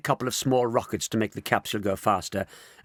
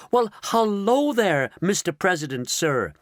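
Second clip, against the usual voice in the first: fawning; 8 semitones higher, 3 dB louder than usual. Acted. Shanks fawning at the President.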